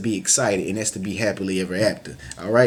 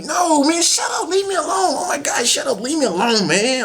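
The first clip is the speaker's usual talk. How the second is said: high, shouted whiny protest; much higher shouted whine: leave me alone. Acted. Friend protests being teased.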